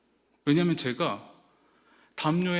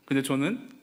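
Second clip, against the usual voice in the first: ordinary talk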